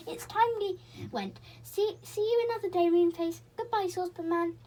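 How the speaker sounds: put-on high, bright girl's voice in a hurry; a cheerful girl saying quick goodbyes; young girl Beth. A narrator's reading. Beth to Moonface, cheerful high farewell.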